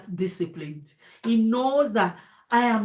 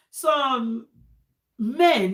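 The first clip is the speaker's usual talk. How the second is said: normal talking voice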